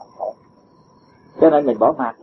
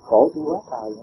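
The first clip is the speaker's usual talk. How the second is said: softer, sighing moan of a weary debtor; mock whining lament; 2 semitones lower, 5 dB quieter than usual. Teacher mimics disciple moaning about repaying debts, soft mock lament. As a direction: play the complaining disciple, half-hearted and soft. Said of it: "mà con cứ than hoài".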